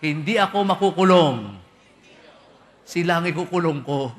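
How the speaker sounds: loud, laughing brag of a debtor; defiant boast. Pretend. Preacher mocking a debtor who brags loudly. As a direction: shout it like a cocky debtor joking.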